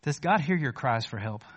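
ordinary talk, nothing marked

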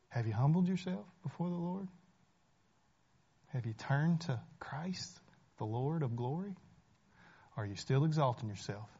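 hushed personal appeal; whispered question. Real, not acted. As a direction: lean in and ask it softly. Intimate altar call question.

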